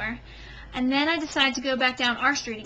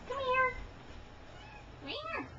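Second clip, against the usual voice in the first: high, soft call to a cat; coaxing a pet; 13 semitones higher, 11 dB quieter, faster than usual. Real, not acted. Woman fetching her cat from behind her, high coaxing. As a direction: coax softly in a high voice.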